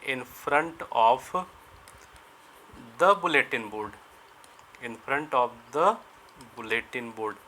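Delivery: each word spaced out, very slow; slow dictation. Shown as a demonstration. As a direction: dictate it slowly so students can write. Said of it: "slow dictation for students". (धीरे-धीरे लिखवाना)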